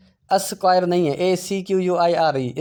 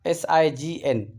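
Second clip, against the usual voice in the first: spelled out; slower than usual. Shown as a demonstration. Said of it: "spelling letter by letter".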